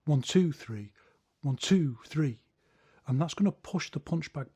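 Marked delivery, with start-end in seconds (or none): 0.1-0.9 two punched, a bit louder; stress on two. Shown as a demonstration. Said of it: "put the emphasis on the cross". 1.4-2.4 two hit loud, three trailing; stress on two. Shown as a demonstration. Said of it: "put the emphasis on the cross".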